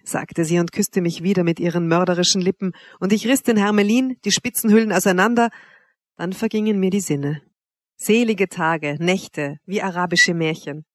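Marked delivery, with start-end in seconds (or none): none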